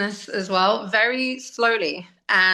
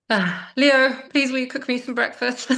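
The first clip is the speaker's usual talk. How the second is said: retold plea; 5 semitones higher than usual. Retold plea to her child.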